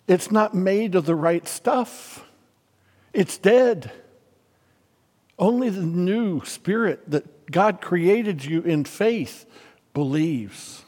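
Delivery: usual speaking voice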